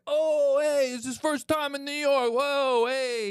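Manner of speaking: high, loud cheering New Yorkers; New York welcome. Retold or imitated. Bar regulars cheer a first-timer in New York, high and loud. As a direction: hail the newcomer like a rowdy bar crowd. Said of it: "they're like".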